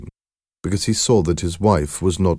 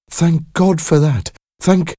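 high relief, breaking off abruptly; relieved exclamation; 11 semitones higher, 5 dB louder than usual. A narrator's reading. Mother thanking God before the truth dawns, exclaiming. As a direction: exclaim high, then stop short. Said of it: "Mary exclaimed".

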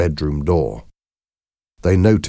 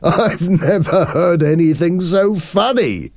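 high-pitched, amused outburst; laughing hard; 13 semitones higher, 4 dB louder than usual. A narrator's reading. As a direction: say it through mounting laughter. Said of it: "Simon started to laugh louder".

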